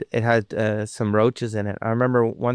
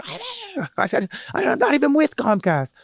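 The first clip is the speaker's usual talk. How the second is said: irate homeowner; 13 semitones higher than usual. Retold or imitated. Angry homeowner retold.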